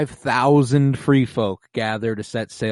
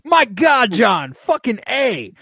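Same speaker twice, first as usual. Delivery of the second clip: high, loud, hammed-up protest; rapid-fire waits into a stretched, high outburst; Tormund's mock exasperation; 12 semitones higher, 3 dB louder than usual. Podcaster playing Tormund pleading with John, exasperated.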